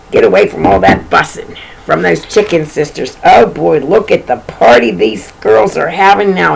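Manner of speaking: ordinary delivery, nothing marked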